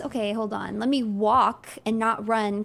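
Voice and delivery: usual speaking voice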